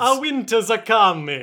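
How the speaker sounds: hammed-up Italian accent. Co-host joking about Game of Thrones, Italian accent. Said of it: "idea of an Italian Game of Thrones".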